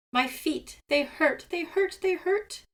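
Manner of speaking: pained crying. A narrator's reading. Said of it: "Seagull screamed again".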